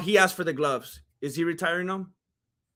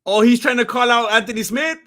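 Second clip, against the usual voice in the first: ordinary delivery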